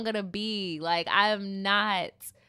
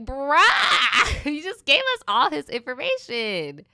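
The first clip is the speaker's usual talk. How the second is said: laughing; 5 semitones higher, 5 dB louder, slower than usual. Retold or imitated. Laughing retort.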